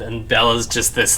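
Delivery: ordinary talk, nothing marked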